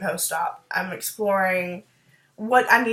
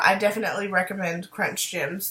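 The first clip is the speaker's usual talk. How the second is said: ordinary talk, nothing marked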